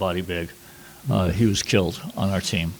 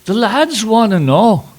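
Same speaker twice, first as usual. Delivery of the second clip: high, loud, slow Scottish drawl; Scottish accent; 12 semitones higher, 8 dB louder, slower than usual. Retold or imitated. A Scottish guard asking Petey about pronouns, in a slow Scottish accent. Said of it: "I also loved your Scottish accent".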